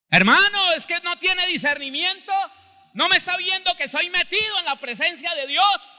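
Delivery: praying brother yelling back at the interrupter; furious shout. Retold or imitated. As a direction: scream it at him, offended and indignant. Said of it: "y me gritó".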